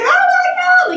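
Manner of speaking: mock scream of sudden terror; retold scream. Thirteen-year-old waking to blood, retold screaming. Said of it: "like, screaming".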